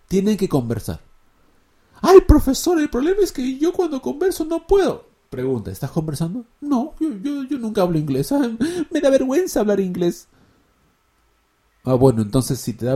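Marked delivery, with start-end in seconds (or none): 2.0-5.1 much higher and louder, complaining; hammed-up complaining pupil; whiny student. 6.6-10.3 much higher, shy mock confession; hammed-up bashful learner; embarrassed student.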